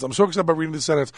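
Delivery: fast, tumbling mock excitement; rushed excitement. Shown as a demonstration. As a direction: rattle it off in a hurry, faking glee. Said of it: "I am so excited about reading".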